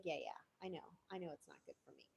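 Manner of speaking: low, soft brush-off; dismissive addict. Retold or imitated. Addict brushing off advice, mild dismissal. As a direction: wave it away halfheartedly. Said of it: "They're gonna be like".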